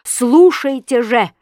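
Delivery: loud, drawn-out demand; insistent shout. A narrator's reading. Husband demands his wife hear him, insistent shout.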